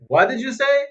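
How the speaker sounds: high, loud imitation of an angry commander. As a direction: demand it like an angered officer. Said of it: "He's all angry".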